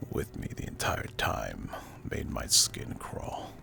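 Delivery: plain reading voice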